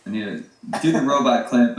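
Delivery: ordinary talk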